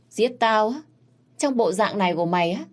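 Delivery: mocking scorn. Prince mocks the beaten prisoner.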